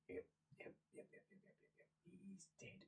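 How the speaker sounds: barely audible repeated whisper; whispered self-assurance. Acted. Prisoner confirming to herself he is dead, whispering. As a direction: whisper it over and over to yourself.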